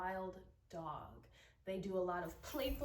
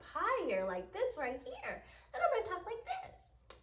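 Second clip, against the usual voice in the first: much higher, squeaky little mouse voice; 11 semitones higher than usual. Shown as a demonstration. Drama teacher demonstrating a mouse voice, very high. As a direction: pitch it way up like a tiny mouse. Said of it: "my mouse's voice is gonna be pretty high-pitched".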